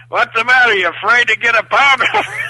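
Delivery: loud, high jeering from the crowd; heckling yell. Retold or imitated. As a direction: yell a taunt from the stands. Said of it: "are actually yelling at Kerry".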